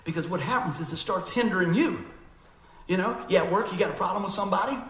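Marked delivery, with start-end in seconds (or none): none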